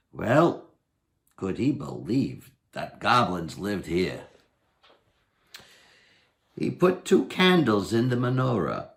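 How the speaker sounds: ordinary delivery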